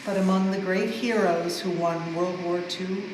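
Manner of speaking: ordinary delivery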